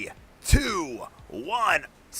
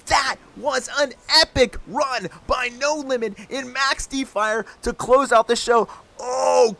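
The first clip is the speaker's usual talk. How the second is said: higher, louder, shouting the praise; hyped shout; 7 semitones higher, 5 dB louder than usual. Real, not acted. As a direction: keep the crowd roaring. Hyped wrap-up after big trick.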